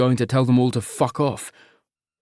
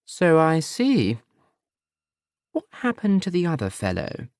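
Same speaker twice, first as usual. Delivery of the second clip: disapproving mother; 5 semitones higher than usual. Acted.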